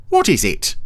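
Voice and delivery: high eager snail voice; Brian voice. A narrator's reading. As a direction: sound high and curious. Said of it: "said Brian".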